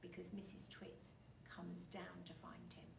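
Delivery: hushed storyteller whisper. A narrator's reading. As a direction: lean in and whisper it. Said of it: "But listen carefully".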